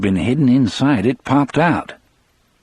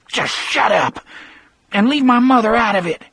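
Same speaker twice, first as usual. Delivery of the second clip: high, wounded snap; humiliated Percy; 13 semitones higher than usual. Acted. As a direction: snap back high and embarrassed. Percy, humiliated, snaps back.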